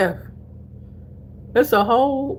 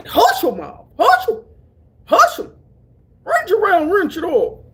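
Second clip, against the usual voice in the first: high, loud mock Southern country twang; country accent; 10 semitones higher, 5 dB louder than usual. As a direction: imitate country folks, pitched up. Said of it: "I love talk to".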